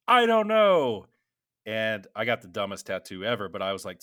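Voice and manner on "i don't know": high, loud goofy voice; clueless answer. Retold or imitated. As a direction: shrug it off loudly in a silly voice. Clueless answer about tattoo.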